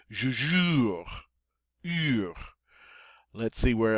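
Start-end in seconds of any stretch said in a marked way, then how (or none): 0.1-2.3 "je jure" with tight, rounded "ee" in "jure"; French U. Shown as a demonstration. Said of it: "long E sound in English through rounded lips".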